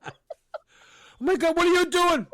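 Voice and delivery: high, exclaimed comic protest; mock alarm. Victim being pelted with blueberries, in high mock alarm. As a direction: cry out high in exaggerated disbelief.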